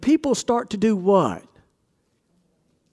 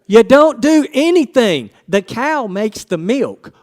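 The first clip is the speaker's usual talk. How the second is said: emphatic; 4 semitones higher, 7 dB louder, slower than usual. Real, not acted. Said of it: "emphatic answer".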